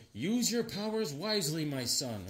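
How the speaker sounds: soft, mock-solemn mentor; lightly intoned advice from a spirit; hushed wise-elder send-off; Neil Adams. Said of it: "so then Neil Adams".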